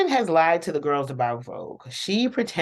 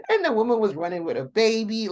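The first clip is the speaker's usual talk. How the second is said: high, quick, laughing words; helpless giggly retelling; laughing while telling; 7 semitones higher, faster than usual. Real, not acted. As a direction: squeeze it out through laughter. Cracking up retelling funny scene.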